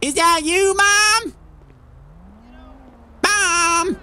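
high calls building to a shout; high excited calls ending in a shout; calling out. Acted. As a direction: call out to her, then cry it. Calling out to long-lost mother.

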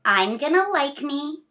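bright, sing-song picture-book reading; reading to children. A narrator's reading. Reading a picture book to a child, sing-song. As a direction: read the rhyme cheerfully to a small child.

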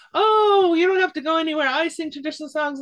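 high, eager, welcoming grandmother voice; eager village woman. Retold or imitated. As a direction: burst out gladly like a delighted old singer. Villager welcoming a song collector.

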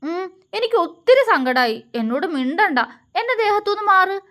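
put-on a hurt kid snapping back; husband angrily tells his wife to get off him; childish anger. A narrator's reading. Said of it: "അവൻ ദേഷ്യത്തോടെ പറഞ്ഞു".

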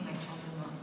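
ordinary talk, nothing marked

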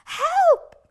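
panicked shout; yelping for help; cry for help. Acted. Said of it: "cried Flip-Flop".

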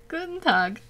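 Guten Tag said through real laughter, high and amateurish; laughing German greeting. Real, not acted. Greeting a German viewer, laughing awkward Guten Tag. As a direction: giggle out Guten Tag like a learner. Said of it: "I'm sure I'm butchering that".

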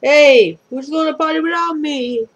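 gruff, loud call. Acted. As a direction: bark it out like a gruff ghost. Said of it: "The gruff voice piped up".

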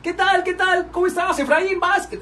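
very high, thin mock tenor voice; el Sorullo impression. Mocking his friend's voice for a listener, very high and squeaky. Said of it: "Si yo tratara de hablar como el sorullo".

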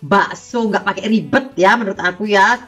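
loud, bright sales pitch; excited. Real, not acted. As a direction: hype it up for viewers.

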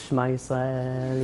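slow, low, softly drawn-out prayer; hushed comic plea of a doomed kid; mock last prayer. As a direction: pray slowly under your breath as if doomed. Terrified kid's mock last prayer.

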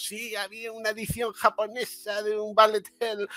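high, soft mocking voice; mocking gamer types. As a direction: put on a small, high know-it-all voice. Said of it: "esta gente, pues".

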